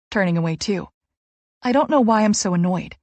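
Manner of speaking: ordinary delivery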